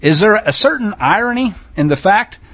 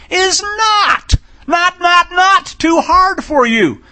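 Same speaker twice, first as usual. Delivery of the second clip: preaching shout; 8 semitones higher, slower than usual. Real, not acted. Preacher shouting the verse at his congregation, full force. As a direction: cry it out from the pulpit, slower at the end.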